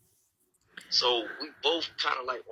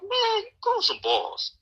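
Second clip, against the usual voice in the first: much higher, slow exaggerated taunt; mock tough guy. Mocking scared husbands in a high hammed-up voice. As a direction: ham up a buddy egging on timid husbands.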